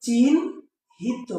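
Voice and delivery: চিহ্নিত drawn out as "chin... hito", n before h; demonstrated pronunciation. Shown as a demonstration. Teacher showing students the pronunciation, চিহ্নিত slowly as chin-hito. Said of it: "এটা উচ্চারণ কি হবে".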